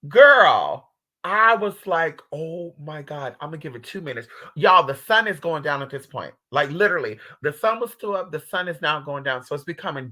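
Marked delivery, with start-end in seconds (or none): 2.3-4.3 hushed inner panic. Retold or imitated. Retold panicked inner thought.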